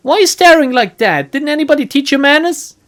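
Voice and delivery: loud, high scolding; rapid-fire reproach. Acted. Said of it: "Fires off a rapid-fire reproach with a thick Filipino accent".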